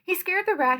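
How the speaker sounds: usual speaking voice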